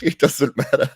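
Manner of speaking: brushing it off while laughing. Real, not acted. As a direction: toss the words off through a laugh.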